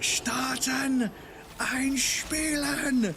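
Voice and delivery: high drawn-out mock scream; mock meltdown. Retelling a German kid's computer tantrum, mock meltdown. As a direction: imitate a kid losing it, stretching the words. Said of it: "he's fucking losing his shit".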